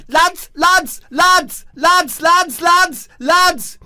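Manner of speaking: high, loud excited calling out. Acted. Debbie bursting in on the lads, high excited calling. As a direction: call the lads, bursting with news.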